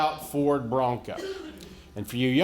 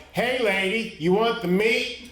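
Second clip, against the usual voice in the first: raised-pitch call to a stranger; 6 semitones higher than usual. Retold or imitated. Man at a crashed driver's window, calling out. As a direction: call through a closed car window.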